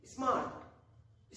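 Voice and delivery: Smart stretched out very slowly; slow demo. Shown as a demonstration. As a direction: draw out smart as one long syllable. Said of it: "जैसे बोलते देखिए". (Smart बहुत धीरे खींचकर)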